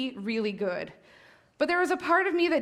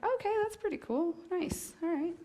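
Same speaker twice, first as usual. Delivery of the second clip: higher, relaxed imitation of her husband; easygoing husband; 6 semitones higher, 6 dB quieter than usual. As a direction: lightly mimic a laid-back guy who's fine with it. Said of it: "He's like".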